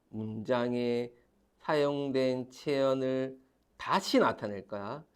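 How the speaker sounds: slow dictation. Shown as a demonstration. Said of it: "dictating a definition again".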